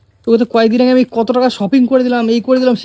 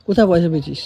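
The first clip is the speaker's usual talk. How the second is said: usual speaking voice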